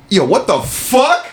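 a hammed-up slow yell of surprise; retelling an overheard shocked outburst; startled exclamation. Brother's reaction heard through door.

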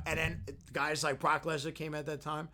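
ordinary talk, nothing marked

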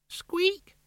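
tiny high squeak, like a toy; rubbery high squeaky toy. Acted. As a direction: squeak it out as a nervous rat. Rat ghost squeaks again.

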